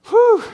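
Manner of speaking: high, loud exhaled whew. Real, not acted. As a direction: let out a big, high "whew" of awe. Reacts to the majestic description.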